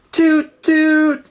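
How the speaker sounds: high, loud toot toot like a train horn; horn imitation. A narrator's reading. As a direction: honk it out like a train whistle.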